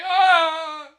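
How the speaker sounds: loud high yell; mock yell. Ripping off the claw, a loud high yell. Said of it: "and I'm like".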